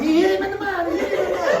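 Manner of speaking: high, loud mock outcry; hollering. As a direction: holler it to the whole crowd. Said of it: "is still hollering".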